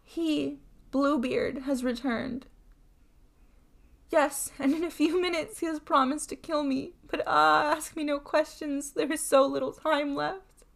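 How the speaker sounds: high-voiced, anguished appeal; desperate pleading. A narrator's reading. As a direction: plead with your sister as time runs out. Terrified wife begging sister for help.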